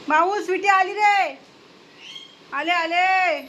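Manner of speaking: high, loud drawn-out holler; calling out. Real, not acted. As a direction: call across the house. Calls out to pet and household.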